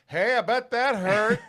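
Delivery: mock yell across a car. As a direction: call it across the car, half laughing. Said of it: "I didn't want to yell across the car".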